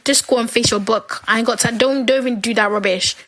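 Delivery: ordinary delivery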